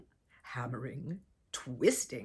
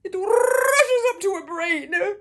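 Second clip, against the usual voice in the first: much higher, loud burst; explosive shout; 16 dB louder than usual. A narrator's reading. Narrator shouting the girl's outburst at the climax. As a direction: blow up on the word.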